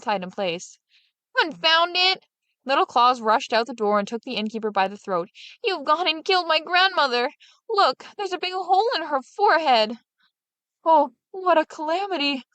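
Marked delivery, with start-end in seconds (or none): 1.4-2.2 high, louder outburst of a hot-tempered innkeeper; angry innkeeper. A narrator's reading. Innkeeper curses as corpse falls. 5.6-10.0 high, lightly voiced accusing Little Claus; Little Claus grabbing the innkeeper by the throat, high mock outrage. A narrator's reading. 10.8-12.4 high, lightly voiced wailing innkeeper; dismay. A narrator's reading. Innkeeper wrings his hands.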